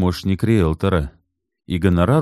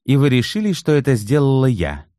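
indignant; 8 semitones higher than usual. Acted. Natalya indignant at accusation.

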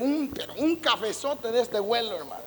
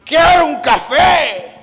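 much higher, much louder, slow barked order for coffee; mock yelled demand; 6 semitones higher, 12 dB louder, slower than usual. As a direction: yell it rudely from across the house. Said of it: "si usted le grita desde allá".

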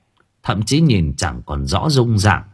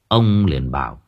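ordinary delivery

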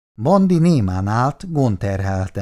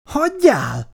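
high, shrill-pitched hysterical outburst; hysterical cry. A narrator's reading. Wife pushing her husband away, hysterical high cry. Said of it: "Kiáltotta hisztérikusan".

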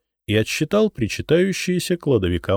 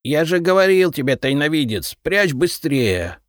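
с упрёком; 8 semitones higher, 4 dB louder than usual. A narrator's reading. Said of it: "с упрёком буркнул Репей".

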